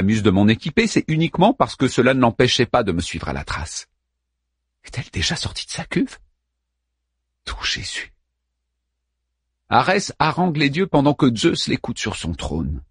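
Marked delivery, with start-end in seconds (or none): 4.8-8.1 hushed, anxious whisper; whispered aside. Real, not acted. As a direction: breathe it under your breath. Pensée inquiète en aparté. (chuchotement inquiet)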